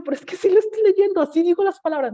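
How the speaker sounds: a schoolgirl raising her voice to defend herself; protesting child. Retold or imitated. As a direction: insist loudly like a wronged kid.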